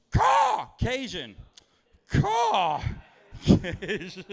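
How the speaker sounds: shrill, very high squawking crow cry; crow caw. Pretend. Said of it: "was like".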